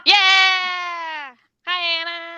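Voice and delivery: high, happy cheer; excited greeting. Real, not acted. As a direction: cheer a friend's arrival. Joins in greeting the new viewer.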